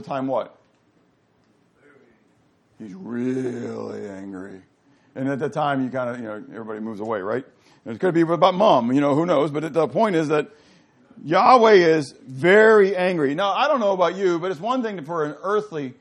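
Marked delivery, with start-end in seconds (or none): 2.8-4.6 low, slow and quietly menacing; ominous low anger. Shown as a demonstration. Teacher showing the class how Dad sounds really angry, low and slow. 11.2-13.3 loud, slow, every word leaned on; emphatic. Shown as a demonstration. Teacher stressing to the class how angry Yahweh is, loud and slow.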